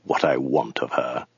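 low, hushed, hissed threat; menacing hiss. A narrator's reading. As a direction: hiss it softly, dropping very low. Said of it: "he hissed".

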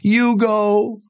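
high, sobbing words; high sobbing refusal. Acted. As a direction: sob it out. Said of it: "she sobbed".

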